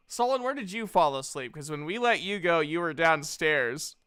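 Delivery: ordinary delivery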